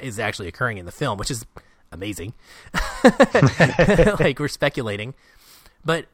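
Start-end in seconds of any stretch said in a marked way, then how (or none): 2.7-4.1 big laugh. Real, not acted. Host laughing at wild movie speculation, loud laugh. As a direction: burst of loud, high laughter.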